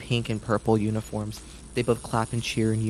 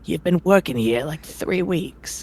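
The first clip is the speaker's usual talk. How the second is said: a gruff, impatient circus ringmaster scolding; 6 semitones higher, slower than usual. Acted. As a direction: grumble at the late performer. Ringmaster again.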